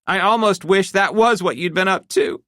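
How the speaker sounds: high, tense, hurt wife voice; a betrayed wife working herself up; upset wife. A narrator's reading. Wife confronting husband about his secret, tense and hurt.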